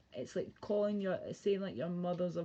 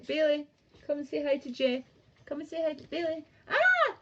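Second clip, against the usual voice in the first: excited; 7 semitones higher, 5 dB louder, faster than usual. Real, not acted. Talking to the dog.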